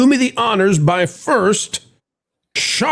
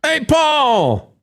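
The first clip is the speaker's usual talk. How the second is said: excited greeting; 10 semitones higher than usual. Real, not acted. Greeting the guest.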